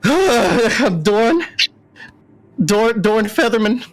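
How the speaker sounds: high, stammering owl voice; flustered owl Dorn. Acted. Owl introduces himself to the party, high and flustered. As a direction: fumble your own name nervously.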